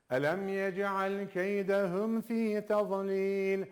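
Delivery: slow chanted recitation; Quran recitation. Shown as a demonstration. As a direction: recite the verse slowly, with tajweed. Recites verse. (yavaş tecvidli tilavet)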